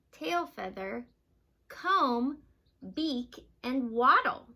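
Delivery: ordinary delivery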